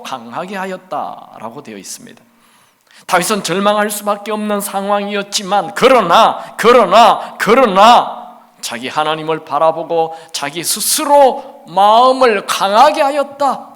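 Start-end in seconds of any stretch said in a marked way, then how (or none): 3.1-13.7 builds louder and higher on each 그러나; rising emphatic preaching. Real, not acted. Preacher to congregation, climbing to a loud slow cry. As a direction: hammer the repeated 그러나, swelling then slowing.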